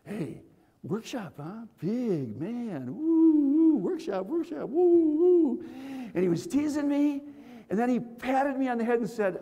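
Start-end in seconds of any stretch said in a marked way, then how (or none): 0.0-5.6 mocking tease. Friend teasing before the big workshop, mocking hoots. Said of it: "now he's gonna tease me".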